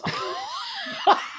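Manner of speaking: high squealing laugh; shrieking laugh. Real, not acted. Laughs at own joke.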